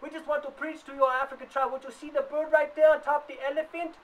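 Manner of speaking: lightly voiced preacher pushing his faith; a hint of a pushy missionary. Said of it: "you had your African tribes going".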